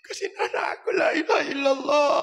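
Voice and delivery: high wailing cry; mother crying. Retold or imitated. Mother reading her son's letter, crying out high. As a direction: wail it like a weeping mother. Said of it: "Ibu pasti nangis".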